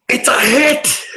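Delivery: high, elated yell; excited cry. Real, not acted. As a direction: cry out in triumph at the lucky roll. Long-awaited hit in the game.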